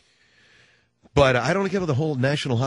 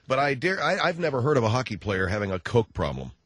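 ordinary delivery

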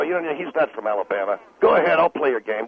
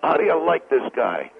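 ordinary talk

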